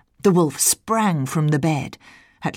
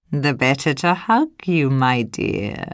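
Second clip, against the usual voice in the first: usual speaking voice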